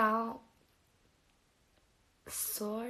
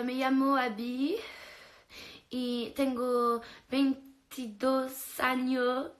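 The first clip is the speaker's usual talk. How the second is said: slow careful Spanish phrase; trying Spanish; 3 semitones higher, 4 dB louder, slower than usual. Real, not acted. Answering a viewer in halting Spanish. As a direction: sound out the Spanish slowly. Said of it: "Is that how you say it?".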